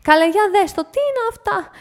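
high, indignant cry; αγανακτισμένο επιφώνημα; outraged exclamation. Acted. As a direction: gasp in disgust at the mess. Lady outraged at the dog.

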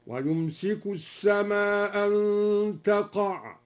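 slow recitation, السماء أن run as as-samā an with one hamza; dropped hamza. Shown as a demonstration. Teacher demonstrates the dropped hamza, slowly reciting السماء أن as as-samā an. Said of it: "بإسقاط الهمزة مع المد والقصر".

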